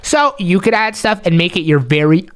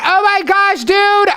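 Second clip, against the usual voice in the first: high, thrilled cry of reunion; excited greeting; 9 semitones higher than usual. Acted. Host finally finds the viewer, excited. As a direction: burst out delighted to see a buddy.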